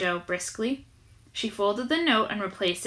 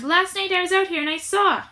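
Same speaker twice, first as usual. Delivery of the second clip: much higher, loud, excited; shrill high voice; 9 semitones higher, 4 dB louder than usual. A narrator's reading. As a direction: burst out with the news. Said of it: "shouting in his shrill, high voice".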